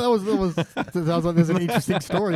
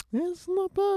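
high mock surprise; 3 dB quieter, much slower than usual. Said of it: "I'm like".

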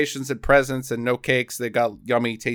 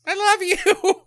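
put-on very high-pitched girly voice; imitated little girl; slower than usual. Girl answers Elfman's speech, high mock adoration. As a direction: squeak out a mock declaration of love. Voicing the little girl's reply.

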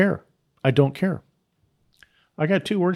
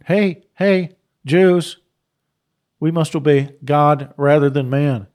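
defiant reply; 5 dB louder, slower than usual. Retold or imitated. Voices Peter's defiant reply.

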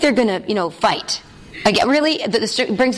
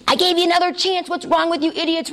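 much higher, mock-angry scolding; imitating a furious king; 6 semitones higher than usual. King berating the three men, mock fury. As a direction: play the king losing patience, hammed up. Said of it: "He goes into fury".

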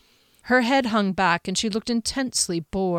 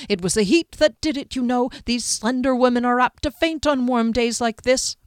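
lightly voiced innkeeper, higher and lively; fussing innkeeper; 5 semitones higher, 3 dB louder than usual. Innkeeper explaining away a woman's faint, lightly fussing. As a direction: play a lightly drawn, chattering innkeeper.

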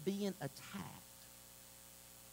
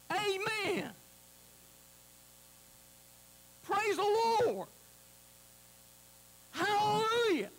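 high, loud bursts of praise; exultant high-pitched holler; praise shouts; 14 semitones higher, 8 dB louder than usual. Acted. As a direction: shout it out like church worship. Calling out praise as changed cooing.

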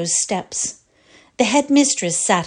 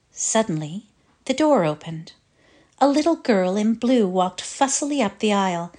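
ordinary delivery

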